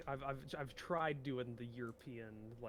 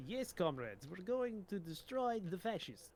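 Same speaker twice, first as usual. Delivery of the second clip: mock Soviet commissar; 6 semitones higher, slower than usual. Answers in mock Soviet role.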